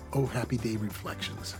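normal talking voice

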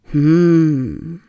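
contented hum with a slight nod; cozy little hum; approving hum. Shown as a demonstration. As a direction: mm as if agreeing. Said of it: "Ein leichtes Kopfnicken dabei".

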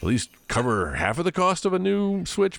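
talking yourself into it; self-talk quote. Retold or imitated. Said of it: "being like".

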